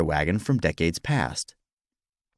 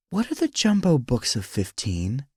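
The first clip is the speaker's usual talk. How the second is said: wife's question; 4 semitones higher than usual. Acted. Wife asks about another sign.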